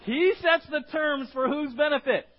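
high, very loud fired-up preaching; rally the crowd at full volume; booming, enthusiastic call to answer. Real, not acted. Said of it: "with a little more enthusiasm".

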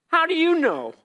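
high, loud, stunned "how do you know"; astonished. Retold or imitated. Asking Randy how he knew, astonished. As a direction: blurt it out in disbelief.

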